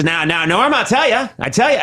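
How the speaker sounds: hoog en luid, een oude handelsreiziger; salesman voice. Retold or imitated. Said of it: "and he says".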